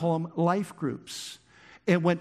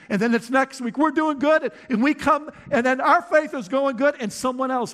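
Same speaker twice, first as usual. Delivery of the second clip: much louder and higher, pounding sermon voice; full-force preaching; 8 semitones higher, 5 dB louder than usual. Acted. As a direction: shout the struggle out to the church.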